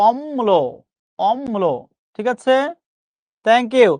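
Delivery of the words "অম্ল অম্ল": "অম্ল" as om-mlo, held m; correct pronunciation. Shown as a demonstration. Teacher confirming the correct answer, "অম্ল" with held m. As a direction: lengthen the m in "অম্ল", twice. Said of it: "উত্তর correct এসেছে".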